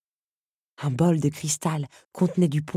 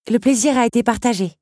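higher, louder polite thanks; courteous young guest; 5 semitones higher, 5 dB louder than usual. Acted. Belle taking leave of the countess, graciously polite. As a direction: thank the hostess with formal courtesy.

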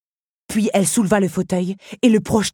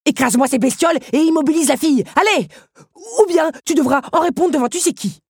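loud, high-pitched furious orders; roaring threat; 10 semitones higher, 7 dB louder than usual. A narrator's reading. Henri orders Otto to crush the insects, in a threatening roar. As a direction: rattle out threats at a shout. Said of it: "rugit Henri".

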